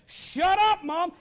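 high, loud mock yell; bratty back-talk. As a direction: imitate a kid yelling back at mom. Himself as child talking back.

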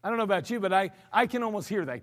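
normal talking voice

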